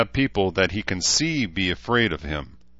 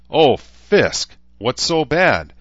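higher, louder startled exclamation; alarmed cry; 7 semitones higher, 8 dB louder, much slower than usual. Acted. Ishtar startled by Shida's reaction, alarmed cry. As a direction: blurt it out in alarm.

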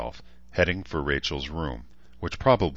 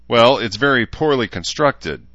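cold; 7 semitones higher, 9 dB louder than usual. A narrator's reading. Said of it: "Danae said coldly".

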